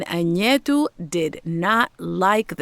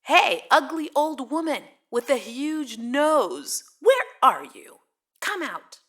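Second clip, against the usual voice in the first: rude yell; 6 semitones higher than usual. A narrator's reading. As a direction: call out insolently in a raised pitch. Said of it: "and rudely yelled".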